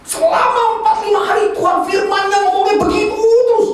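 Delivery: high-pitched exasperated complaint; retold exasperation. Preacher recalling the same word every morning, mock exasperated. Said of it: "kesel saya".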